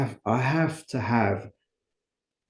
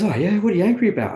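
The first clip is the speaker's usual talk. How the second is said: higher, louder, fast and upbeat; sponsor's quick curious question; 10 semitones higher, 7 dB louder, faster than usual. Retold or imitated. Sponsor on the phone asking Paul why he's angry, quick and upbeat. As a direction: toss the question back brightly and quickly. Sponsor asks about anger.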